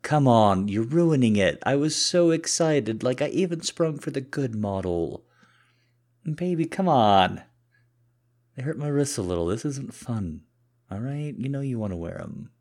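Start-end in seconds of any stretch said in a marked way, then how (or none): 0.0-7.4 high, loud, exasperated pleading; whining complaint. Acted. Cuffed man whose plan is being ruined, whining. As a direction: whine at a partner spoiling the fun.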